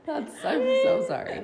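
apologizing through high laughter; sorry, barely holding in laughs; laughing. Real, not acted. Laughing apology after a burp.

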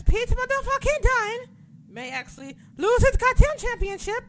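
high, loud mock British yelp; UK fan impression. Announcing the title match, high mock British squeal. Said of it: "That's how I'm gonna say his name".

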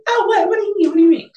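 high, loud, incredulous questions; mock bewilderment. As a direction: replay being totally caught off guard, pitch way up. Acts own bewildered reaction again.

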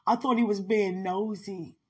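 ordinary talk, nothing marked

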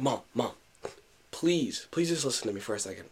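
soft, low, urgent aside to mom; retold plea, kept down so nobody overhears; hushed pleading. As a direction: beg quietly for a moment's attention. Pleading privately with mom in parking lot.

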